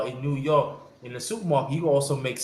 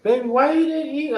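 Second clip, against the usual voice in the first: mom's caring question; 12 semitones higher than usual. Retold or imitated. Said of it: "my mom's always like".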